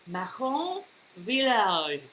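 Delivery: slow, drawn-out French attempt; bad French accent. Shown as a demonstration. Host naming where the wine is from, bad French accent. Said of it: "and I do a bad accent".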